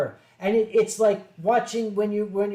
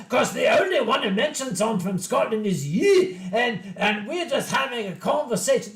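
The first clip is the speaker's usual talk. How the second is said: Scottish accent impression; 3 semitones higher, 3 dB louder than usual. Convention kid insisting he's not from Scotland, mock Scottish accent. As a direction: play the Scottish furry kid, hammed up. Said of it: "This is pretty much how the conversation went".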